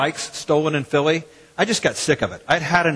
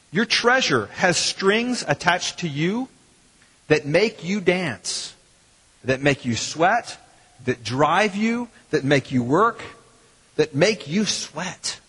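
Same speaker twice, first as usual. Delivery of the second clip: slow emphatic preaching; slower than usual. Acted. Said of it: "preaching the marionette image".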